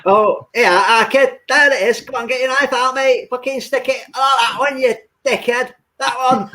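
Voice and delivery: high-pitched bickering lout; tyre-slasher voice. Acted. Slashing tyres on the wrong bus, high lout voice. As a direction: goad a dim accomplice in a high put-on voice. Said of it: "What's that voice you did".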